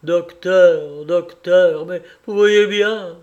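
begging the doctor in despair; desperate plea. A narrator's reading. Captain pleading desperately with the doctor, loud and high. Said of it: "d'un geste désespéré".

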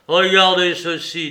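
a loud defiant order; loud command. A narrator's reading. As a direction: thrust it at him loudly. Heathcliff confronting Edgar.

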